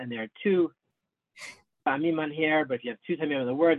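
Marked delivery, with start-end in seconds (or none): none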